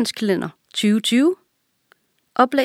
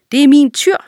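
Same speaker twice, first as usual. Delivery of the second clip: louder, higher counterclaim; insistent contradiction; 7 semitones higher, 8 dB louder, faster than usual. A narrator's reading. Lion disputing the ostrich's claim to the calf, insistent. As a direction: contest it firmly, pitch raised.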